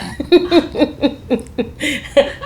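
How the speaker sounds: high-pitched giggle; amused laugh at her own joke. Real, not acted. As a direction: let a high laugh bubble out.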